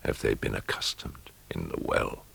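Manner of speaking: a hushed inner question; whispered thought. A narrator's reading. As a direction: whisper it to yourself.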